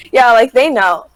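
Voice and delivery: usual speaking voice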